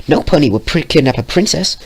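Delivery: put-on bubbly girl voice. Pink pony speaking.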